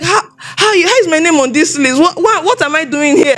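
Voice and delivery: high-pitched, stammering disbelief; retold bewilderment. Newcomer finds own name on the list, acted bewilderment. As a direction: act out baffled protest, voice lifted. Acting out disbelief at being listed.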